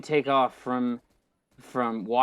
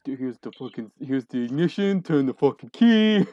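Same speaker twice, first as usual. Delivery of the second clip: mock clueless pilot; 4 dB louder than usual. Critic mocking the pilot starting the jet, rising to loud. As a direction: fumble through a jet like a car. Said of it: "and he's like".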